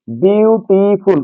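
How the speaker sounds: বিউটিফুল drawn out very slowly in three separate syllables. Shown as a demonstration. Teacher has students count lip movements, বিউটিফুল slowly syllable by syllable. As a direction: break বিউটিফুল slowly into বিউ, টি, ফুল. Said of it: "কবার নড়ল?".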